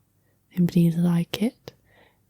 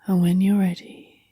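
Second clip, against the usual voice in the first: guided sleep hypnosis; 5 dB quieter than usual. Acted. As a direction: ease the listener gently towards sleep, unhurried and hushed.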